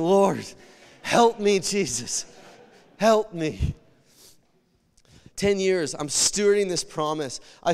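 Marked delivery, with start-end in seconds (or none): 0.0-3.8 loud cry for help. Real, not acted. Crying out to God in church.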